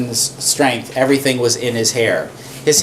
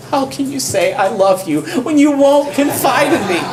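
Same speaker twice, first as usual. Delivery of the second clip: much higher, hammed-up whine, half laughing; whiny nagging Delilah; 11 semitones higher than usual. Delilah nags Samson, hammed-up high whine. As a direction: overdo the nagging lover's complaint. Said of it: "With such nagging".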